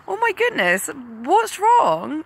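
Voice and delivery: high worried exclaim; concerned surprise. Retold or imitated. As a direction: ask it alarmed and caring. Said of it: "And I said to him".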